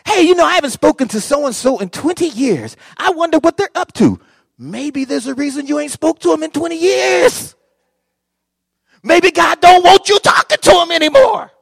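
high, loud rising exhortation; preaching shout. Real, not acted. Preacher building to climax.

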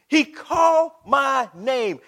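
slow, loud, word by word; deliberate, spaced pulpit emphasis; drawn-out repeat. Real, not acted. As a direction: stretch each word out with weight. Same words, drawn out slowly.

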